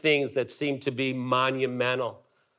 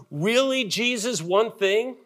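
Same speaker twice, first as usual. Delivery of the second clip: higher, questioning "one thing?"; incredulous; 6 semitones higher than usual. Real, not acted. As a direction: push back in disbelief. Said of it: "incredulous question to Jesus".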